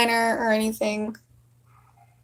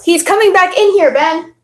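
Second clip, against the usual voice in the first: urgent call; 7 semitones higher, 4 dB louder than usual. Real, not acted. Urgent to brother.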